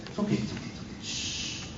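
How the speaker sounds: whispered, fading to a shush; acted soothing whisper; hushed reassurance. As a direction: hush them under your breath. Hushes objecting listeners in retelling.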